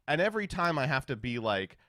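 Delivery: normal talking voice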